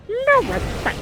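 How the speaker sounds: botched cartoon shark voice; Jabberjaw. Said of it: "I cannot do Jabberjaw".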